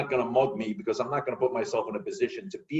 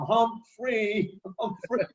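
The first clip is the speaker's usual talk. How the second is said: calling a name; 9 semitones higher, much slower than usual. Retold or imitated. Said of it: "on the corner going".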